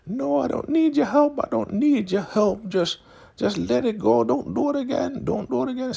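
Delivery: very high falsetto plea; mock frantic begging. Elder Mother begging Zane to let go, high mock plea. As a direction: imitate a desperate woman pleading.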